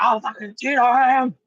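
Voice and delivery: high, shouted mock rage; hammed-up, high-pitched angry rant; confrontational imitation. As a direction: imitate a man yelling right at someone. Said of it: "he's like, right at me".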